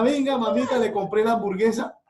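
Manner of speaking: high, loud coaxing mother voice. Retold or imitated. Mother coaxes her heartbroken daughter to eat, high coaxing. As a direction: mom sweetly urging a sulking child. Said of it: "Mi mamá".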